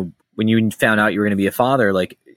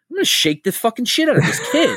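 high-pitched acted frustration; mock exasperated outburst; 11 semitones higher, faster than usual. Parent after twelve hours of screaming, hammed-up exasperation. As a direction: blurt it high, at wits' end. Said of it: "your first instinct as a real person".

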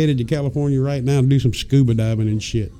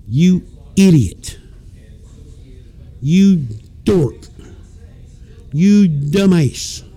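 mocking insults; 3 semitones higher, slower than usual. Real, not acted. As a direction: scold him like a fool. Insulting the Michigan man.

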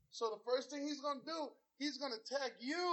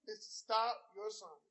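ordinary talk, nothing marked

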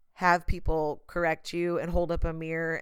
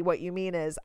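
usual speaking voice

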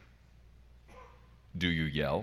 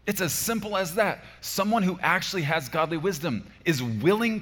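higher and louder preaching build; preaching at full force; 8 semitones higher, 7 dB louder than usual. Acted. Pastor preaching to the congregation on yielding, full force. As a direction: drive the point home to the congregation.

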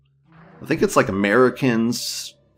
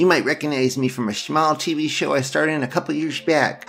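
higher-pitched mock public-announcement voice; raised, performed spokesperson voice, easing slightly toward the end; Maisie Williams; 5 semitones higher, 3 dB louder than usual. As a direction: read it as a put-on celebrity address. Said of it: "My name is Maisie Williams".

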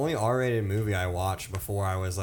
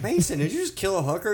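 high, quick mom questioning her son; mock alarmed mom; 10 semitones higher, 4 dB louder, faster than usual. Mom questioning her son about the game, mock alarm. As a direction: put on a higher, hurried mother's voice. Voicing friend's mother in a joke.

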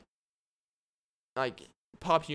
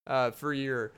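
ordinary talk

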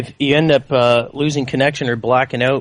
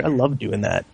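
normal talking voice

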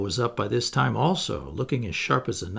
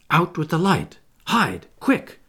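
urgent, higher-pitched orders; commanding cry; 4 semitones higher, 3 dB louder than usual. A narrator's reading. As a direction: take command in a hurry. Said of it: "cried John, taking command".

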